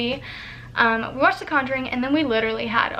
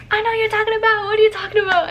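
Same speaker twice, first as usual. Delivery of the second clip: high, insistent prying; imitating a demanding friend; nosy friend; 10 semitones higher, 5 dB louder, faster than usual. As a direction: press for the secret, pitched way up.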